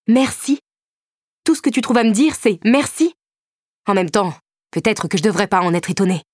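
high, incredulous and angry; stung, sarcastic anger; indignant disbelief. A narrator's reading. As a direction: throw her word back at her. Angry repeat of her word.

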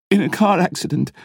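tearful, high broken voice; sobbing. A narrator's reading. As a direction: speak between sobs. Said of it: "continued Emma between sobs".

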